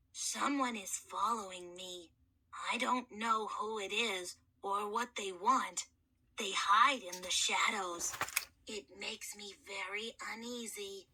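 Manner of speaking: put-on a boy's voice unused for millennia; gentle, courteous mummy soothing a scared boy; hoarse mummy kid. Mummy voice held.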